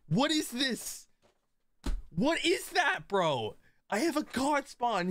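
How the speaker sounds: high-pitched outraged yelling; shaking with anger. Real, not acted. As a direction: squeal in furious disbelief. Friend's move ruins god spawn.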